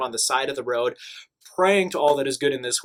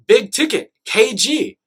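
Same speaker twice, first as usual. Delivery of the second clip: louder and higher, eager Croatian guard; excited guard; 8 semitones higher, 8 dB louder, slower than usual. Retold or imitated. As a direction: call out the nickname like a fan. Guards naming basketball star excitedly.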